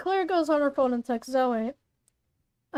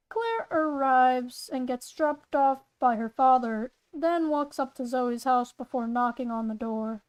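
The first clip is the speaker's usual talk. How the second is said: usual speaking voice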